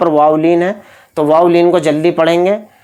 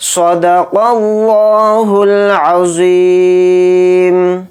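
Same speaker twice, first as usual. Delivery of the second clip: slow, drawn-out tajweed chant; Quran recitation; 2 semitones higher, much slower than usual. Shown as a demonstration. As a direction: recite it slowly and melodically. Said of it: "एक बार हम पूरी सूरत को repeat करेंगे".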